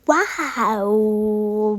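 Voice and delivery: a very long drawn-out mock wow; exaggerated wow. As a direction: stretch it out like a fake taste-test rave. Tasting coffee, exaggerated reaction. (Coffee चखकर बहुत लंबा खींचा हुआ नकली वाओ)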